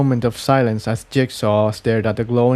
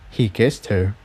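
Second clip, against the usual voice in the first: ordinary delivery, nothing marked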